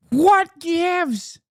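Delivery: high, drawn-out mock whine on what gives, stressed what; mock indignation. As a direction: whine it up high like a pouty tweet. Mocking the tweet's question.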